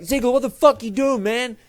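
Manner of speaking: put-on JBL in a poor American accent, high and loud; bad American accent. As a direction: shout it in a hammed-up American accent. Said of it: "That is the worst J B L accent".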